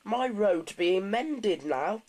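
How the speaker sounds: cheerful bus. A narrator's reading. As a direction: share good news, drawing it out. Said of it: "He looked much more cheerful".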